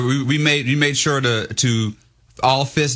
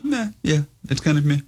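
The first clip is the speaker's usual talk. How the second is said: unimpressed meh; 5 semitones higher than usual. Retold or imitated. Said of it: "I got a lot of".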